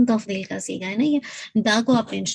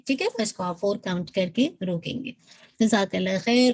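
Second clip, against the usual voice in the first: ordinary talk, nothing marked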